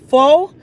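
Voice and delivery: Fo? loud and rising, r dropped; mock the word Fo?; incredulous fo. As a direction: repeat Fo? in disbelief. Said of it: "to say the word".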